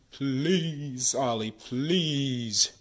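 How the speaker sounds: pleading. Real, not acted. Collector begging Ollie for a real card, pleading. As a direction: stretch each please into a long plea.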